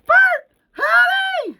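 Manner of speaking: very high, loud horrified scream; horrified cry. Acted. Horrified Heather calling after Bert, shrieking. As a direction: call out in horror for your husband. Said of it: "Heather is horrified".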